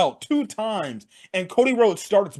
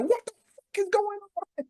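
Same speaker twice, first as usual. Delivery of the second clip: high, squeaky mock outrage; Michael Cole, bewildered; 12 semitones higher, 5 dB quieter than usual. Imagined commentator swearing at the chaos, high squeaky shock. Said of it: "I'm shocked he didn't even say".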